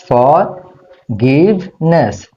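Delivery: ফরগিভনেস slowly as ফর, গিভ, নেস; three separate syllables. Shown as a demonstration. Teacher repeating for learners, ফরগিভনেস slowly in three parts. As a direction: break ফরগিভনেস into three clear beats. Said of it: "তিনটা syllable করেছি।".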